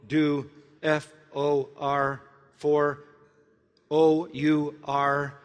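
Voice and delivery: slow letter-by-letter spelling. Retold or imitated. Spelling out the board message.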